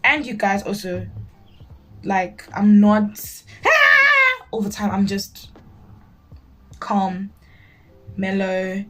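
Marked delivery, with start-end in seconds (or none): 3.6-4.5 high, loud mock squeal; hammed-up shriek held on one note; mock scream. Imitates an over-excited person, unlike her.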